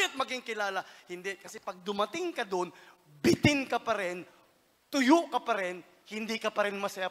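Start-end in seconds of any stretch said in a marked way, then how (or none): 3.2-7.1 loud, punching each short phrase; forceful sermon emphasis; emphatic loud preaching. Acted. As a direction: hammer the point home.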